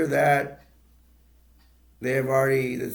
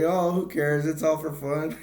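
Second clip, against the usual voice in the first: higher, louder mock brush-off; retold breezy dismissal; 5 semitones higher, 4 dB louder than usual. Clark brushes off his wife's complaint, higher retold dismissal. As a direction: imitate shrugging it off, amused.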